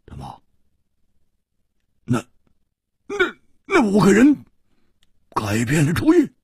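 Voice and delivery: stammering, hoarse disbelief; hoarse, halting question. A narrator's reading. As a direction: stumble over the first words, voice failing. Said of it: "哑声说".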